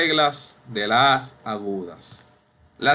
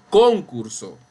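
concurso wrongly said CÓN-curso, slow; stress on first syllable; 5 dB louder than usual. Shown as a demonstration. Teacher showing class a wrong form, concurso stressed CÓN-curso. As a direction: punch CON in concurso, drop the rest. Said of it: "Y tampoco".

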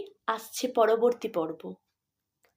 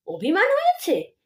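higher scornful mimicry of অভিমান হয়েছে; mocking echo; 8 semitones higher, 4 dB louder than usual. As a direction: throw her words back with a sneer. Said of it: "ভুঁ ভেঙিয়ে বলে ওঠেন".

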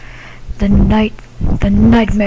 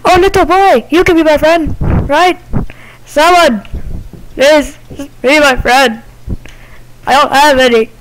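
pleading ghost; 5 semitones higher than usual. Acted.

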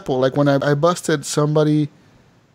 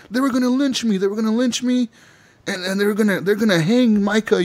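panicked retelling; 6 semitones higher than usual. Retold or imitated. Charles panicking about a lynching.

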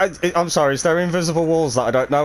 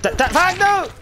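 alarmed shout; 14 semitones higher than usual. Real, not acted. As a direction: yell out as the save fails. Teammate dies, cry out.

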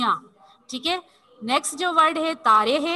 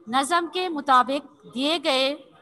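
each word read slowly and clearly; slow dictation; slower than usual. Shown as a demonstration. Teacher reading an exercise to children, slow dictation. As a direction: read the task out slowly so pupils follow. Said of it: "reading the task aloud".

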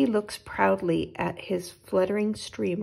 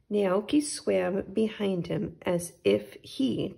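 ordinary delivery, nothing marked

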